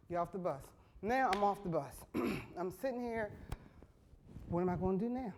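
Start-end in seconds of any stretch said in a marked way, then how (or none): none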